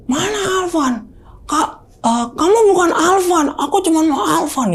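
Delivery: high-pitched possessed granny impression; hoarse granny voice. Possessed girlfriend rejecting everyone but Alvan, high hoarse granny voice. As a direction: put on a high, strained old woman's voice. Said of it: "suara nenek-nenek tapi serak gitu".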